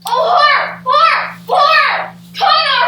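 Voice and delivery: loud, high anguished outcry; cry of horror. Acted. A nobleman rousing the castle after finding the murder, loud horrified cry. Discovers murdered king, cries out.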